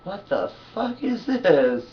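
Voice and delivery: slow, high and loud disbelief; mock outrage at a video; drawn-out exasperated question. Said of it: "I'm like".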